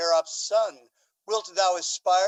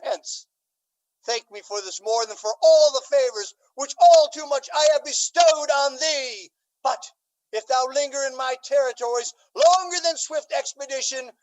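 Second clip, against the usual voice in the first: furious shout; 5 semitones higher, 5 dB louder than usual. Acted. The Duke banishing Valentine, furious shout. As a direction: roar him out of the court.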